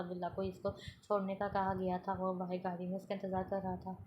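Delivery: ordinary delivery, nothing marked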